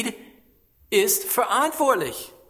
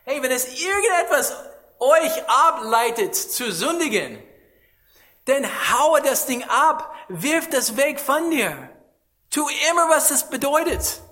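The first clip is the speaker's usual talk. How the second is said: higher, louder exhortation; preaching at full force, higher and louder; fiery preaching; 6 semitones higher, 4 dB louder than usual. Acted. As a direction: urge them hard to cast it away. Preacher urges flock to cut off sin.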